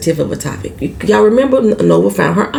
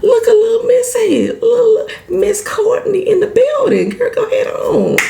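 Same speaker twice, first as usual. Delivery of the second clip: much higher, sassy and delighted; playful sassy praise, pitched way up; impressed, teasing Nova. Retold or imitated. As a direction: hype the girl up like a proud auntie. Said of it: "Nova was like".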